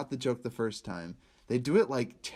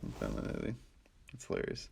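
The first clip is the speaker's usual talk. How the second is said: whispered aside; whispering; 10 dB quieter, faster than usual. Real, not acted. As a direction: whisper it under your breath. Mock aside to co-host.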